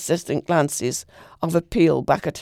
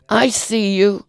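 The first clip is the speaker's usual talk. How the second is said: spiteful shout; 4 semitones higher, 4 dB louder, slower than usual. A narrator's reading. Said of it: "shouted the old man spitefully".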